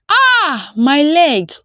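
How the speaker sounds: high, loud cry of pain; anguished shout. A narrator's reading. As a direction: cry out as the leg is seized with pain. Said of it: "Obi shouted in anguish".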